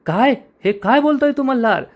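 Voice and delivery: stunned sister shouting at her brother; shocked shout. A narrator's reading. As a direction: yell it out in disbelief. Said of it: "तायडी जोरात ओरडते".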